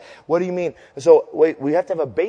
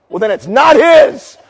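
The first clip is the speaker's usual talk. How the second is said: exasperated retort; 3 semitones higher, 7 dB louder than usual. Retold or imitated. Preacher to church lady about the beast, exasperated shout. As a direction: holler the obvious at her.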